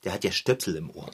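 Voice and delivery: quiet aside. Acted. Aside, noticing the earplugs.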